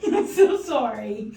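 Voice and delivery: laughing. Real, not acted. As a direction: laugh through the apology. Apologizing after gag reflex test.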